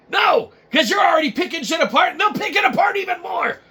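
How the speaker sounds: high, loud pleading yell; begging the audience to stop, loudly; exasperated shouting. Acted. Host shouting at nitpicking viewers, exasperated.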